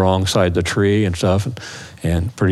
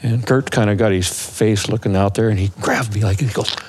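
ordinary talk, nothing marked

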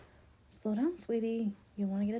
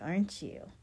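normal talking voice

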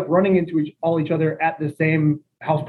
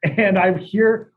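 ordinary talk

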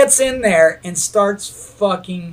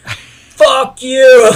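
loud, higher grumpy outburst; groggy angry snap; 5 semitones higher, 8 dB louder than usual. Retold or imitated. Hungover friend yelling at the waker, angry shout. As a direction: bark it back half asleep. Sleeping friend snaps back.

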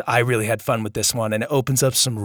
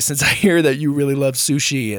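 ordinary delivery

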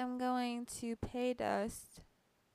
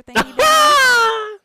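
indignant outburst; 19 dB louder, much slower than usual. Real, not acted. Outraged telling of the snub.

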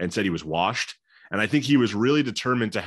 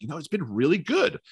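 higher, mildly surprised retold reply; slight surprise; 7 semitones higher than usual. Player answering how he is enjoying this, slightly surprised. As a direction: imitate an athlete's understated surprise. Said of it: "almost sense of surprise in his voice".

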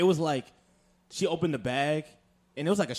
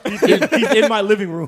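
laughing fake panic, louder; hammed-up fright; mock panic; 6 semitones higher, 9 dB louder than usual. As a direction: play scared while cracking up.

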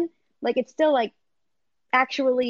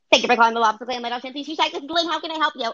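restaurant phone greeting; 3 semitones higher, faster than usual. Acted. Receptionist answering a call at the seafood shack, chirpy phone greeting.

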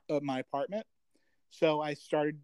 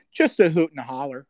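louder hammed-up elderly Midwesterner; 7 dB louder than usual. Shown as a demonstration. Mocking their own phrase, hammed-up elderly Midwestern. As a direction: play up the old Midwestern folksiness. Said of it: "I sound like an elderly Midwestern person".

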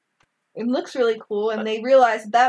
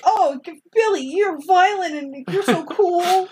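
high, mock-adoring gush; gushing fan; 7 semitones higher than usual. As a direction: swoon over him like a starstruck fan. Mock fan gushing over Billy.

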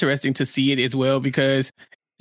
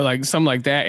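usual speaking voice

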